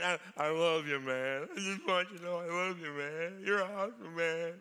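a hint of a slurry, sloppy drunk; mock drunk buddy. Drunk buddy telling his friends he loves them, lightly mocked. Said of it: "they get drunk".

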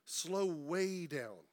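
drawn out very slowly. Shown as a demonstration. Pastor urging his church to slow down, said very slowly. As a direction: stretch each word, x-slow. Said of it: "slow down".

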